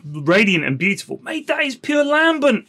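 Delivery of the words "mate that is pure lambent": excited mock slang. Trying out slang words.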